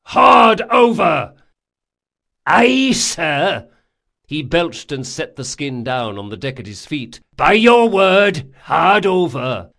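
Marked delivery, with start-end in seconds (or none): 0.0-1.3 high, drawn-out bellow; shouted order. A narrator's reading. Captain to sailing master, shouted command. 2.4-3.7 loud, high acknowledgement; shouted reply. A narrator's reading. Sailing master to captain, shouted reply. 7.4-9.7 slow, loud, high deck call; shouted reply. A narrator's reading. Sailing master at the wheel, shouted.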